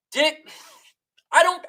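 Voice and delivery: ordinary talk, nothing marked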